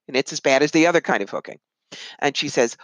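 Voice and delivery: ordinary talk, nothing marked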